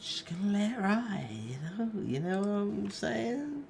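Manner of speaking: goofy high voice on a whim; odd high voice. Real, not acted. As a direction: slip into a silly high voice. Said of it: "I don't know why I'm talking like this".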